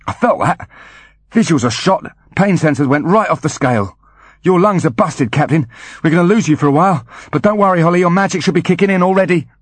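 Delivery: high, quick technician chatter; Foley's voice. Acted. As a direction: rattle it off fast, reassuring. Foley over radio, reassuring.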